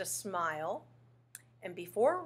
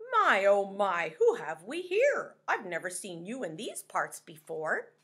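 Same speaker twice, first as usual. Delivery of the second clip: pufferfish voice; 7 dB louder than usual. Acted. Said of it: "said the pufferfish".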